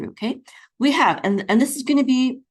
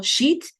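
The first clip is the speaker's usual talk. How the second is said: "sheet" alone, accented and higher; stressed final syllable; 8 semitones higher than usual. Shown as a demonstration. Teacher isolates the accented syllable, higher and stressed. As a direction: punch out "sheet" with a rise in pitch. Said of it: "the accent is on the last syllable".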